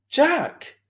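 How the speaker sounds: high, loud scolding cry; sharp rebuke. A narrator's reading. Mother scolding son for backtalk, loud high cry. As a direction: snap his name out in alarm.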